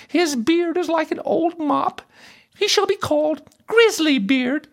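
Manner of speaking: very high, ridiculing princess voice; mocking princess. A narrator's reading. Princess nicknaming a bearded king, mocking. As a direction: make fun of him in a high-pitched voice. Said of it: "she laughed more than all".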